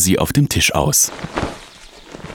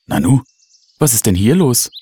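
puzzled question lifting higher; astonished; 6 semitones higher than usual. A narrator's reading. Mother finding something unexpected, astonished. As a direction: wonder at it, mildly amazed. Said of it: "fragte sie erstaunt".

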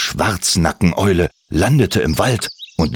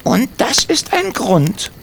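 high, slow and pointed; stern kobold question; slower than usual. Acted. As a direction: ask it slowly so they feel ashamed. Timmy rebukes the children.

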